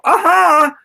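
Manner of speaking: high, loud "aha!" of discovery; mock eureka cry. Lecturer acts out learning from a book, mock eureka cry. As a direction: burst out as if suddenly enlightened.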